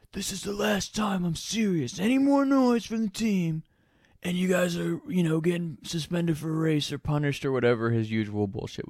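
a high, strained coach laying down the law; stern coach warning. Retold or imitated. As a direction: play the fed-up coach giving a last warning. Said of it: "our coach comes over".

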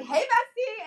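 high, sing-song bestie greeting. Retold or imitated. Imitating the boy greeting her, high chirpy bestie. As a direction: chirp it like a friendly crush. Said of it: "He'll come up to me like".

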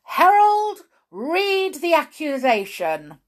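loud, high royal order; commanding king. A narrator's reading. King ordering the herald to read, loud command. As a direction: call it out grandly as a king. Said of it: "said the king".

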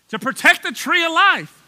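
loud, high mock-earnest reading; mock misreading. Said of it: "here's how y'all read it".

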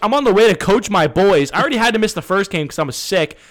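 fired-up retelling of the crash; pissed off. Retold or imitated. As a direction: vent the anger hard. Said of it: "I'm pissed".

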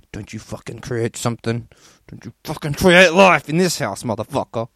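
hammed-up furious father warning his kid; mock angry dad. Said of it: "one's like".

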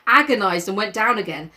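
plain reading voice

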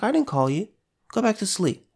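a hint of a sleepy old priest brushing the boy off; old Eli, dismissive. Eli telling young Samuel to go back to sleep, mildly voiced. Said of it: "Eli's like".

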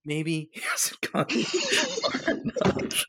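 hammed-up dramatic pronouncement; dramatic Lenny. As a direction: let it hang like a big reveal. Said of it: "he's like".

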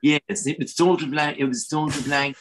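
hammed-up British royal RP; Received Pronunciation. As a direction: put on an old-fashioned royal RP accent. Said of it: "Received pronunciation".

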